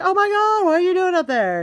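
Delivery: very high, loud mock shock; high-pitched, hammed-up disbelief; astonished retold shout. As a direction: squeal it up at her in mock amazement.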